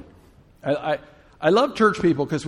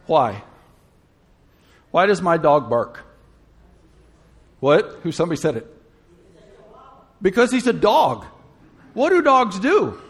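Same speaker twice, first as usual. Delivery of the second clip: ordinary delivery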